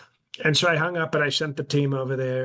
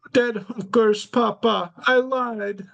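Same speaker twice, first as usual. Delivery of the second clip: put-on high, slow European accent, dropping the article; Barron with a European accent; 10 semitones higher, 4 dB louder, slower than usual. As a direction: son confessing to dad in a high European accent. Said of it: "he speaks with a European accent".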